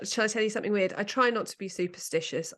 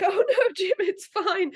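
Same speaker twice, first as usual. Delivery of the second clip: high, loud mock-soothing mum voice with a laugh; 12 semitones higher, 6 dB louder than usual. Mum consoling Jim on the caterpillar ride, mock-soothing. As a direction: imitate mum trying to calm the wailing toddler. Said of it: "my mum being like".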